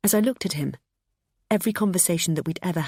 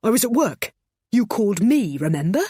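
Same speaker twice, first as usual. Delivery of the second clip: loud, higher angry retort; 5 semitones higher, 5 dB louder than usual. A narrator's reading. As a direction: snap it back at her. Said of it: "barked Adam".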